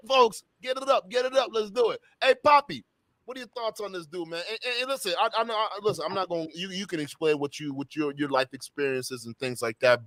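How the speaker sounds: normal talking voice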